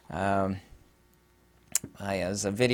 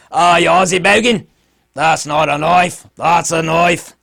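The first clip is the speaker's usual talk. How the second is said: very loud, higher, hammed-up Aussie voice; horrible Australian accent; 6 semitones higher, 12 dB louder than usual. Joking about a utility knife, exaggerated Australian accent. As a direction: bellow it as a broad mock Australian. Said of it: "my horrible Australian accent".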